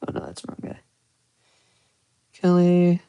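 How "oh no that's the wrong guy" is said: breathy whisper under his breath; whispered aside. Real, not acted. Streamer whispering to himself after clicking the wrong viewer. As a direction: hush it like a private oops. Mistake while modding chat.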